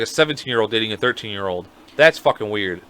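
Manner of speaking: usual speaking voice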